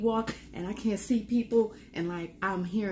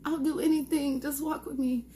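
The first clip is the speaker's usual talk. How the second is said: inner pleading; 8 semitones higher than usual. Retold or imitated. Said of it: "I was just like, in my inside".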